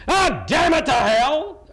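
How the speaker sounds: high, loud, drawn-out angry curse; angry outburst. Acted. Showing students what a lady isn't supposed to say, angry outburst. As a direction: explode in exasperation, stretching the words out. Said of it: "come across in an angry fashion".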